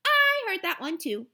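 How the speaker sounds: pushing back harder this time; insistent little girl. Acted. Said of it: "Jenny said".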